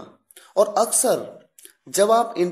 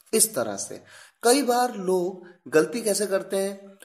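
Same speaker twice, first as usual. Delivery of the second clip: ordinary delivery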